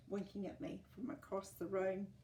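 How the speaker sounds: usual speaking voice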